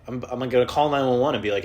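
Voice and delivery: ordinary talk, nothing marked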